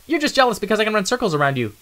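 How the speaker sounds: high, quick and cocky; a restless kid who can't wait; high, impatient youngster; eager young mouse. Acted.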